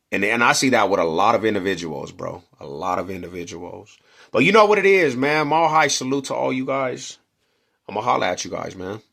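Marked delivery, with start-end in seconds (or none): 4.3-7.2 higher, louder outro shout; hyped sign-off. Real, not acted. Saluting viewers at the end, hyped loud outro. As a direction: pump up the crowd as you sign off.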